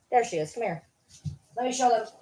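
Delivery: ordinary talk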